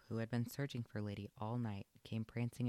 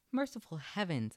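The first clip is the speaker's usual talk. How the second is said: higher, louder startled outcry; shocked exclamation; 7 semitones higher, 5 dB louder than usual. A narrator's reading. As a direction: gasp it out at the dead rat. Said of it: "exclaimed Aunt Sarah".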